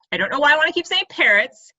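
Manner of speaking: usual speaking voice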